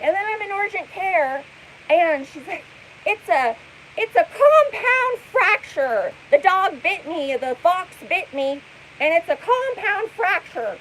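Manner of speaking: imitating a hyper streamer's injury story; hyper mimicry. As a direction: ham up her injury drama. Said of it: "hyper, like, almost screaming".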